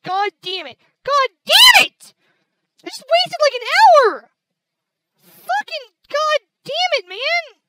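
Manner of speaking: high, loud, drawn-out cursing; exasperated yelling, leaning hard on the curses; real angry frustration. Real, not acted. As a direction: cry out over the wasted work. An hour of recording wasted.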